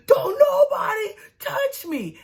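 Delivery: very high, drawn-out mock scream; screaming breakdown. Michael refusing to be grabbed, mock screaming breakdown. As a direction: imitate a hysterical wail, slow and strained. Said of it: "He having a freaking mental breakdown".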